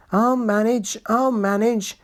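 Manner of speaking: wheezy, out of breath; wheezing. A narrator's reading. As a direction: wheeze it out bravely. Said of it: "he wheezed".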